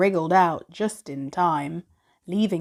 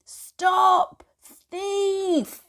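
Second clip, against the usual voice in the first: put-on much higher, shouted cry of an old gardener; angry call; 12 semitones higher than usual. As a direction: yell after the rabbit waving a rake. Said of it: "calling out".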